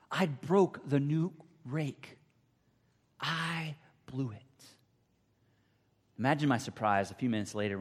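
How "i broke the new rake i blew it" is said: slow, broken, near tears; tearful child. Retold or imitated. Boy sobbing alone over the broken rake, slow and tearful. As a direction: let the guilt slow every word. Boy weeping in his bedroom.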